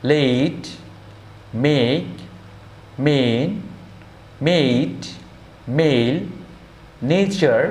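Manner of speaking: each word clearly separated, with a pause after; example words, a as "ay". Shown as a demonstration. Teacher listing words for students, a as "ay", carefully. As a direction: say গেম and মেক with a clear "ay" vowel. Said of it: "এ যখন এর মতো উচ্চারণ".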